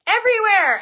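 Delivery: cheerful teacher answering her own quiz; excited call-out. Real, not acted. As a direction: shout the answer to the whole room. Answering her own question to viewers.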